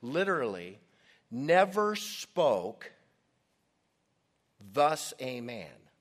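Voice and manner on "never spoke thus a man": slow, weighty "Never spoke", a long pause, then "thus a man"; emphatic word order. Shown as a demonstration. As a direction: hold back "thus a man" and set it down firmly. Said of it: "emphatic in the original Greek".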